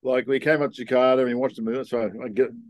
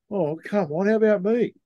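rising, higher whine of a jealous wingman; mock whiny complaint; 9 semitones higher than usual. Wingman whining as girls flock to his mate, mock plaintive. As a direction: plead like the ignored friend. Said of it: "I'd just be just sitting there going".

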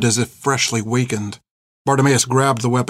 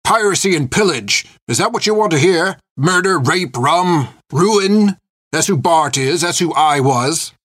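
put-on old pirate's outburst, pitched up; explosive anger; 6 semitones higher, 3 dB louder than usual. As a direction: burst out in bitter confession. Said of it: "he exploded upon it".

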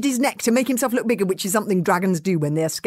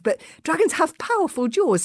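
ordinary delivery, nothing marked

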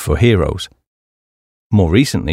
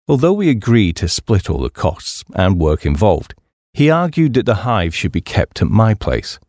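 ordinary delivery, nothing marked

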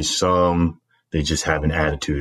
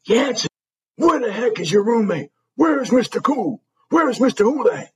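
angry dressing down. Retold or imitated. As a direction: bark at the plebe in a rage. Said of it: "Storming over to me".

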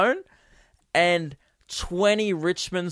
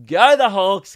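loud, higher team chant; yell; 4 semitones higher, 7 dB louder than usual. Retold or imitated. Everyone in the carriage heard me, loud yell. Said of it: "I just yelled".

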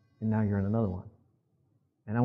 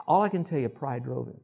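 ordinary talk, nothing marked

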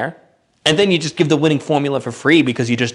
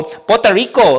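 high, loud, mocking Spanish pronunciation; showy Spanish PUERTO RICO; exaggerated Spanish accent; 8 semitones higher, 5 dB louder than usual. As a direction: burst into an overdone Spanish accent. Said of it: "talk like this and then go".

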